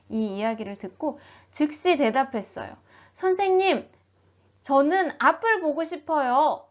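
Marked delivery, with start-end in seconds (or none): 3.1-6.6 louder, higher earnest answer; eager plea. A narrator's reading. Blind man tells Jesus his wish, earnestly pleading. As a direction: answer at once, loud and pitched up.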